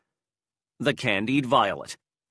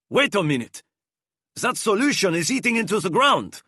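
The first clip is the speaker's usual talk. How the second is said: higher suspicious voice; suspicious guard; 8 semitones higher, 3 dB louder than usual. A narrator's reading. Guard notices the solution, suspicious. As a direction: catch on, wary.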